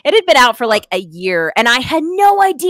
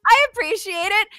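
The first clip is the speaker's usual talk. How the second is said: very high, chirpy imitated reply; oblivious cheerfulness; 11 semitones higher, 3 dB louder than usual. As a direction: chirp it politely, missing the joke entirely. Retells chirpy reply, missing the joke.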